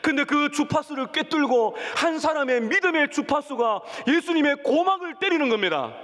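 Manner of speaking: ordinary talk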